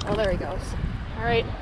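ordinary talk

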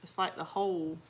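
ordinary delivery

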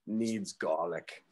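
put-on slow, low Australian accent; deadpan Aussie bushman, slowly. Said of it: "with this Australian accent".

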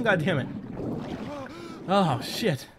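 ordinary delivery

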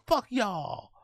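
exaggerated American rapper voice; American accent. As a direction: toss it out in put-on American. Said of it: "do my best American accent".

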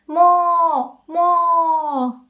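high, loud mooing "Mo, mo"; cow moo. Shown as a demonstration. Teacher imitates a cow for students. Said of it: "That's the sound cows make".

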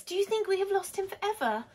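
high, quick, anxious question; worried child. A narrator's reading. Lola fears the dog is gone, high and worried. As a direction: ask it fretfully in a little girl's voice.

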